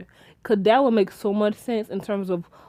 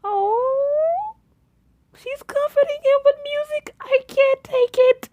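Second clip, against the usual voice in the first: much higher gushing squeal, slowing on the last words; overwhelmed squeal; slower than usual. Retold or imitated. Vlogger swooning as Ren sings to comfort Ben, much higher squeal. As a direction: squeal like the scene is too sweet to bear. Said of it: "I'm like".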